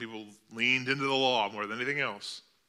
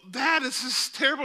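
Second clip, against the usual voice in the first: put-on scared child; 13 semitones higher, 4 dB louder than usual. Scared boy in storm.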